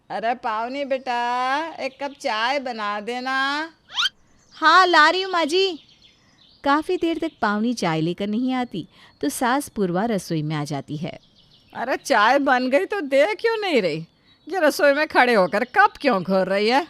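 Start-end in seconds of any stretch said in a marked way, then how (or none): 0.1-3.3 put-on slow, drawn-out elderly call; mother-in-law voice. Mother-in-law slowly calling daughter-in-law for tea. 4.6-5.8 loud, higher reply; calling out. Acted. Daughter-in-law calling loudly back to mother-in-law. 11.7-16.9 put-on a louder, scolding older woman; mother-in-law voice. Mother-in-law scolding daughter-in-law in the kitchen, louder.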